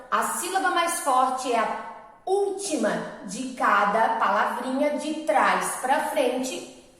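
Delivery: ordinary delivery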